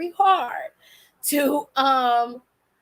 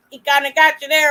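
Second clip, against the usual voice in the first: ordinary talk, nothing marked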